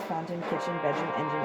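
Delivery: ordinary delivery, nothing marked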